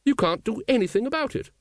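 high, untroubled lady's voice; high-voiced matter-of-fact lady; unconcerned. A narrator's reading. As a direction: carry on as if nothing were amiss, pitched high. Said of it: "quite unconcerned".

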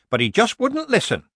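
high-pitched, meek excuse. A narrator's reading. Old witch explaining the boy's tantrum, meek. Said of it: "said Granny meekly".

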